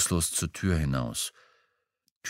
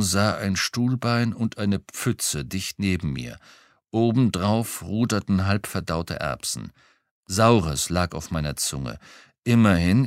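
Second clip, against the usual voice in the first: ordinary delivery, nothing marked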